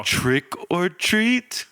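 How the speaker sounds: high, slow, hesitant trick or treat; mock-timid foreign kid asking; unsure foreigner. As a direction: ask it like a confused newcomer. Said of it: "I'm just like".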